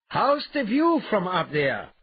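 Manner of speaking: loud, high, teasing call. Acted. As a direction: call it up to someone hanging high overhead. Calling up to suspended brute.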